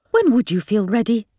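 shrill, indignant question; shrill it at her. A narrator's reading. Mum confronting her unready daughter, shrill. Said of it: "shrilled Mum".

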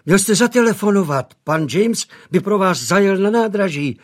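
a hint of a fussing housemaid; eager maid. A narrator's reading.

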